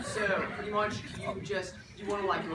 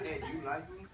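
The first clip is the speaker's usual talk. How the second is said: flat, soft "Hey, do you like me?"; deadpan, no tune; monotone; 3 semitones lower, 5 dB quieter, faster than usual. Shown as a demonstration. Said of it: "go flat, monotone".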